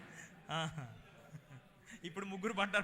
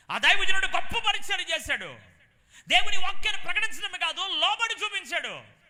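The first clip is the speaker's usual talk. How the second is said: loud, high, ringing preacher's cry; full-force preaching; 11 semitones higher, 14 dB louder than usual. Acted. Preacher exalting the man of God to the congregation, loud and high. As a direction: preach it out to the whole hall, rising high.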